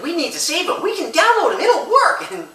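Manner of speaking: high, bright-eyed mock wife voice breaking into a laugh; wife pitching her rescue plan, high and giggly; eager wife. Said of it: "She's like".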